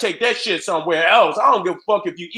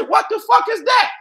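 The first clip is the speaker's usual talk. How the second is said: shocked outburst; 11 semitones higher than usual. Retold or imitated. Host reliving his shock at hearing about mountain oysters, shouting. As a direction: yell it like you just heard something crazy.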